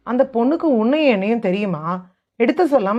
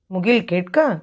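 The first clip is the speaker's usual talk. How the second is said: usual speaking voice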